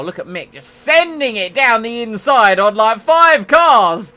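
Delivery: excited shout. Real, not acted. Cheering Mick as he passes five cars, loud excited yell. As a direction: cheer him through the gap.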